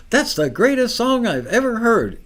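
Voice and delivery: high, breathless gushing; overexcited fan raving about a song; gushing rave. Retold or imitated. Mock gushing over a song.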